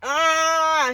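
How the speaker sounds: loud, insistent demands, pitch climbing; imitating a father who means business; not playing. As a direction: fire off protective demands, stretching the words out. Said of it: "he like, he not playing".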